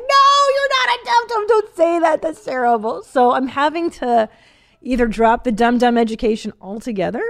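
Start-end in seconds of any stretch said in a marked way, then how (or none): 0.0-3.0 high, loud wail of a retold mom; mock-horrified outburst, pitch leaping up; horrified protest. Mom protests son calling himself a dum-dum, loud mock horror.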